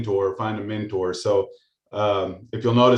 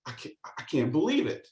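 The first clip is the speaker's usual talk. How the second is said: higher, softer, awed disbelief; amazed Luke; 4 semitones higher, 6 dB quieter than usual. Retold or imitated. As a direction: breathe it out like a stunned young Luke. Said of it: "and he goes".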